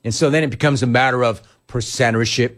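usual speaking voice

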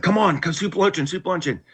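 high, eager coaxing; eager invitation. Retold or imitated. Drinking buddy urging him along.

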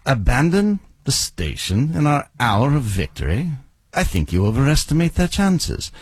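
crisp British imperial officer accent; Tarkin. Shown as a demonstration. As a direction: deliver it in a commanding British accent. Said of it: "go to Star Wars to find the accent".

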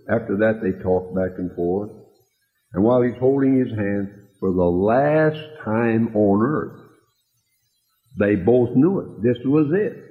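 normal talking voice

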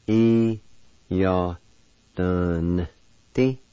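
"E, ya, dun, ti" with pauses, N sounded in "dun"; syllable-by-syllable demo. Shown as a demonstration. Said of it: "with the D V like this".

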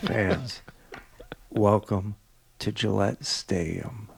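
hushed, low take with pauses between phrases; Nick. Retold or imitated. Doing the stadium greeting as Nick, low and soft. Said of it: "[name], do it as Nick".

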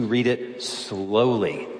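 slow right down, dragging the word SLOWLY; slow, drawn out. Shown as a demonstration. Preacher advising how to read Proverbs, slow and deliberate. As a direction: stretch out SLOWLY to illustrate it.

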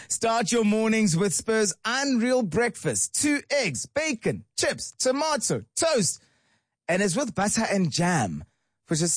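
bright radio ad read, high on the list of food; upbeat ad read. Acted. Host reading the Spur ad to listeners, upbeat and high. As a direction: sell the breakfast line by line. Reads a breakfast ad.

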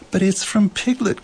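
high, startled exclamation; crying out. A narrator's reading. Christopher Robin has read the message, crying out. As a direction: cry out at the discovery. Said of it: "cried Christopher Robin".

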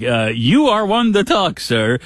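mock-stern teasing reply; sarcastic retort.

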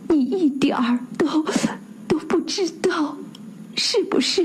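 high, soft, stumbling over the words; stammering plea. A narrator's reading. Husband stammers a plea to Christophe, hushed and rising. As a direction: beg while stammering, voice climbing. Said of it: "哀求的目光结结巴巴地说".

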